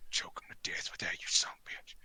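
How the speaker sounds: fierce acted whisper; conspiratorial whisper. Wrestler hands over wooden hand, whispering. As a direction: whisper it low like a secret order.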